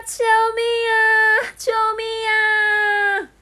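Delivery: very high frightened cry for help; screaming for help. Acted. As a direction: shriek like a snatched princess. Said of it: "吓得尖叫".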